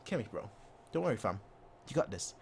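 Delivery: a coach soothing Kimmich, soft and low; soft coach reassurance. Retold or imitated. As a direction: murmur calm encouragement. Flick voiced reassuring.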